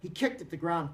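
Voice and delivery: usual speaking voice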